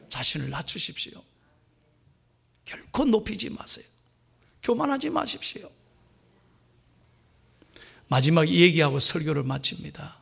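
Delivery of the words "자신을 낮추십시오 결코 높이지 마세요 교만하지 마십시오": quiet, earnest pleading; soft exhortation. Acted. Pastor telling the congregation to humble themselves, softly. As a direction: drop the voice and plead gently.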